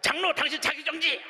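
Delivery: high-pitched mock shout; imagined yell back. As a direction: pretend to yell right back at the elder. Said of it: "나도 소리 꽥 질러야 되는데".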